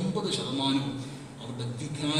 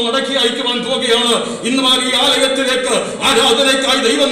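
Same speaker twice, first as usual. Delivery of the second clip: louder sermon swelling again; rising preaching; 9 semitones higher, 11 dB louder than usual. Acted. Preaching swells over music. (ഉയരുന്ന ആവേശത്തോടെ പ്രസംഗം)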